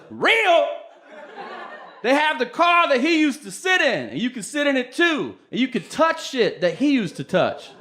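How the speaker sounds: high-pitched, fervent gushing; excited fan. Retold or imitated. Fan outraged at being doubted.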